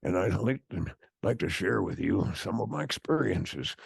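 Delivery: put-on a wise old timer creaking along; old man voice. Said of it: "talk to you in my old man voice".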